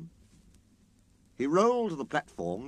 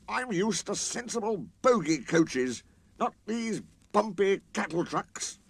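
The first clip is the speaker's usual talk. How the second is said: complaining engine; 9 semitones higher than usual. Acted. Sir Handel complaining.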